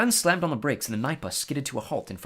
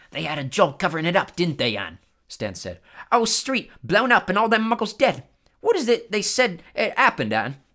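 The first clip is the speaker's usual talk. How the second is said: higher, louder Cockney storyteller lad; gossipy bus conductor; 6 semitones higher, 7 dB louder than usual. Acted. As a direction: spin the tale with relish. Stan gossiping on.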